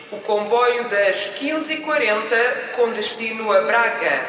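loudspeaker announcer voice; formal public address call; station announcement. Acted. (anúncio de estação pelo altifalante)

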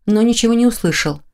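usual speaking voice

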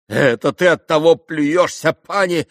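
loud, high outburst; desperate. A narrator's reading. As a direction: throw it out like a desperate man. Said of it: "проговорил Митя как отчаянный". (отчаянный громкий выпад)

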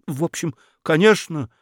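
high, hesitant, flustered; flustered mumble. A narrator's reading. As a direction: falter as if caught off guard. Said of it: "растерянно пробормотал Федяшев". (растерянно, неуверенно)

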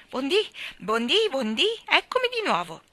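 high, loud sing-out greeting; joyful cry. A narrator's reading. Starling landing back on the windowsill, joyful shout. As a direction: cry it out overjoyed, high and stretched. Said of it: "gridò tutto gioioso".

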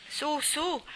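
Teddy urging them eagerly upward; cried out. Acted. Teddy calls up to the boys in the nest: excited high call. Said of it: "gridò Teddy".

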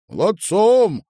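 high, booming congratulation; loud praise. A narrator's reading. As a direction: shout praise across the workshop. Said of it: "громко проговорил он". (громкая, высокая похвала)

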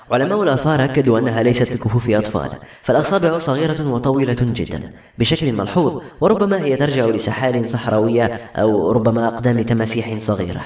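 plain reading voice

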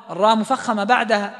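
ordinary talk

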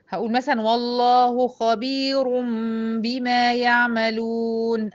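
خبير with a heavy ر, recited slowly; slow recitation carrying through خبير; heavy ra, no stop. Shown as a demonstration. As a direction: recite والله خبير بما يعملون in slow Quran style. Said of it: "ما وقفتش على خبير".

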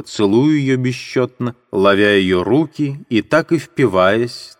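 usual speaking voice

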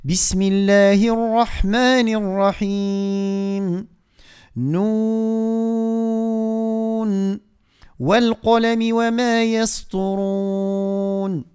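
slow, drawn-out tajwid recitation; Quran recitation. Shown as a demonstration. Teacher recites for the listeners, slow tajwid chant. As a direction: recite each word long and measured.

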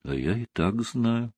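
wife answers her husband quietly on the phone. A narrator's reading. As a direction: say it quietly, already knowing. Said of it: "тихо ответила жена".

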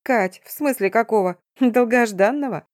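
lively, mildly incredulous pep; amused friend. A narrator's reading. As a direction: toss it out with light amusement. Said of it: "хохотнула подруга".

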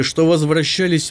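usual speaking voice

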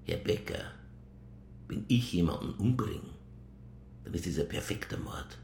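menacing whisper. Acted. Detective boasting of perfect murder.

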